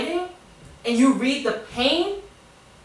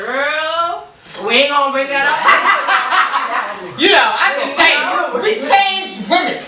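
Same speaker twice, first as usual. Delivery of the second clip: laughing through the words, much higher and louder; 5 semitones higher, 6 dB louder than usual. Real, not acted. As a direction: giggle it out while teasing a friend. Laughing about past crimes.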